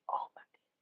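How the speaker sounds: stunned hushed whisper; shocked whisper. Retold or imitated. Hearing the friend's page is gone, stunned whisper. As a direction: let the shock leave you breathless. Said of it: "I said".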